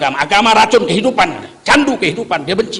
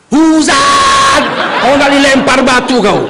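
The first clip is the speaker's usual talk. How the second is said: loud Batak-accented bellow; logat Batak; 4 dB louder, slower than usual. Shown as a demonstration. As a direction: belt it out in a blunt Batak accent. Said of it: "Coba lagu [name] pakai logat Batak". (logat Batak yang lantang)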